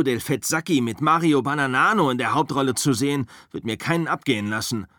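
mock the Italian westerns with scorn; Rick's tirade. Rick rants to Cliff about Italo-westerns, fast and scornful.

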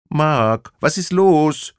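high, loud, pleading female voice; worried woman calling. Acted. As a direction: call out in alarm for Mark. Woman calling Mark again.

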